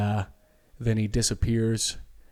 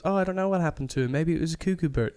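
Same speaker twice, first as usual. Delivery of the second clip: put-on English accent; 6 semitones higher than usual. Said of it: "And she's English".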